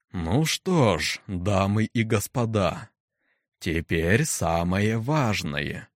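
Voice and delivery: slow, deep, impassive voice; deep stern necromancer. Acted. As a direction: speak low and slow, like a judge. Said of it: "Грозный голос некроманта гулко падал в тишину, глубокий, низкий, бесстрастный".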